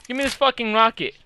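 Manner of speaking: ordinary talk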